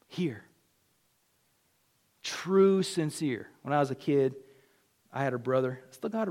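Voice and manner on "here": "here." whispered softly, pointing to the heart. Shown as a demonstration. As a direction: breathe "here." as a hushed whisper. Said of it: "not just here".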